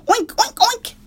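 very high, loud squealing oinks; boy oinking in the crate; pig oinks. Acted. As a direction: squeal like a piglet.

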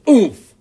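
loud, high thump-landing grunt. A narrator's reading. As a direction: let out a startled, loud "oomph". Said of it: "he grunted".

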